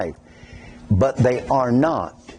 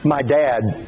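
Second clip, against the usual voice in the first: normal talking voice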